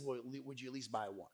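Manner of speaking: low, quiet imitation of the kid asking; hushed, modest request; soft follow-up plea. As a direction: ask small and softly. Kid's soft follow-up ask.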